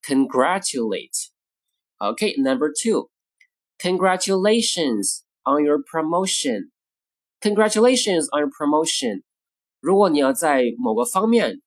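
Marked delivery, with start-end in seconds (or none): 0.0-1.3 "congratulate" stressed on GRAT; stress on second syllable. Shown as a demonstration. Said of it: "重音呢到变成了第二个音节". 3.8-6.7 measured, word-by-word phrasing. Shown as a demonstration. Model phrase, slow take first.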